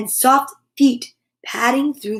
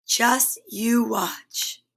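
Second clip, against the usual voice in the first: slow confident; 2 semitones lower, 5 dB quieter, much slower than usual. Acted. Kip calm, slow.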